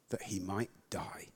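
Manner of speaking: whispered, barely there; hushed whisper. A narrator's reading. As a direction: drop to a bare whisper on his wish to die. Narrating Elijah's wish to die.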